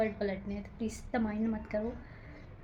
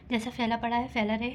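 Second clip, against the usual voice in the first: usual speaking voice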